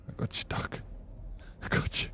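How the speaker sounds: whispered repeat of the line. Shown as a demonstration. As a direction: breathe it out as a secret.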